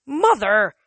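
loud, high cry of protest; shocked protest. A narrator's reading. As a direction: cry out at your mother. Annabeth protests her mother.

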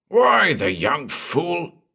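put-on slow, high scornful bark of an old man; angry bark. A narrator's reading. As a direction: spit out contempt for the young fool. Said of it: "barked the belligerent Bain".

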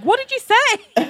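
mock bewildered shout dissolving into laughs; calling down, laughing. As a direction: call down to him while cracking up. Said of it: "calling down to John".

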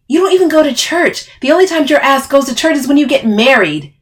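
loud, high, heated accusation; mock their claim angrily; scornful retort.

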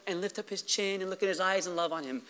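very soft, low voice; quiet, loving murmur; tender hush. Real, not acted. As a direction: describe it gently and intimately. Describes a loving father's tenderness.

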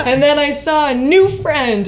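high, loud laughing words. Real, not acted. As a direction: laugh through the line. Laughing about the mouse.

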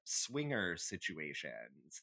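ordinary delivery

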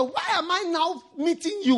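high-pitched imploring question; retold exclamation. People to preacher on meeting late, acted exclamation.

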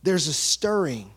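ordinary delivery

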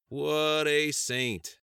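very slow, loud and high; sarcastic exclamation. Acted. Sheriff about his nagging secretary's husband, sarcastic. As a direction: stretch out each word loudly.

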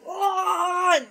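sharp high grunt of effort as the hammer swings; a quick strained ah. Acted. GM voices Dubois's smash.

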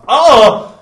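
high loud sitcom yelp; comic exclamation. Pretend. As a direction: cry out for the camera. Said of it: "the camera zooms in on my face and it's like".

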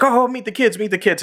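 high mock-chirpy introduction; high cartoon voice showing off the boyfriend; imitating Mister Garrison. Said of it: "he's like".

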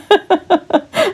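a quick high laugh. Real, not acted. Catching herself, short high laugh.

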